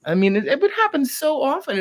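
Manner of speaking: ordinary delivery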